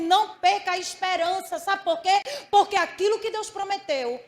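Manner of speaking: loud, rising exhortation; preacher urging the congregation, loud and fervent; preaching at full force. Acted. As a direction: drive hope home to the listeners.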